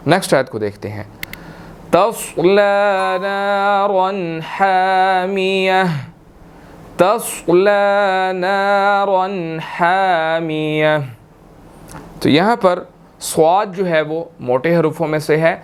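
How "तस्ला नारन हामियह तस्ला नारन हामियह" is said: slow, drawn-out tajweed recitation; slow Quranic recitation. Shown as a demonstration. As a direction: recite it slowly with heavy letters. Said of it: "teacher recites the verse".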